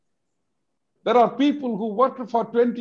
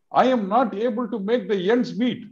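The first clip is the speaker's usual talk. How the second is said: ordinary talk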